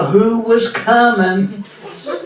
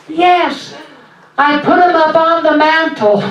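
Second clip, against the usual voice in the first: put-on high child-like voice; 7 semitones higher than usual. Shown as a demonstration. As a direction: pitch it up like a kid on the phone. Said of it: "my voice goes up high".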